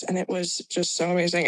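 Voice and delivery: ordinary delivery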